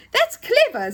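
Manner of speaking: high, loud exclamation; delighted. Acted. Dave admires Joe's coded song, delighted. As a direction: exclaim with admiration, pitched right up. Friend delighted by clever trick.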